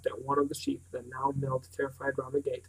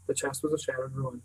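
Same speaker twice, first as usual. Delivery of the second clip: usual speaking voice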